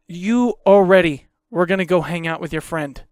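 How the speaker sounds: ordinary talk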